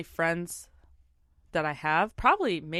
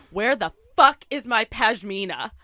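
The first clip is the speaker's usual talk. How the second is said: frantic and angry; 3 semitones higher, 7 dB louder than usual. Retold or imitated. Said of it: "frantic and pissed".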